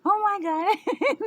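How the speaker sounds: slow, high, loud mock wail; drawn-out panic. Daughter mimics mom's long panicked wail. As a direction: stretch the panic out like mom.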